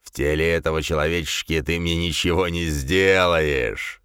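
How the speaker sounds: low, slow gloating fiend; malicious demon voice. A narrator's reading. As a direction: sneer it out with malice. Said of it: "злобно рассмеялась тварь".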